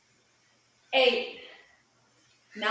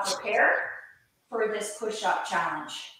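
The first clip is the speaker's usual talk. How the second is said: ordinary talk, nothing marked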